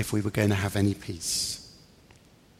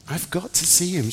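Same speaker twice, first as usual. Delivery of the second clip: higher-pitched insistent plea; pleading; 8 semitones higher than usual. A narrator's reading. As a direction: plead to be let through. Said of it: "as she pleaded".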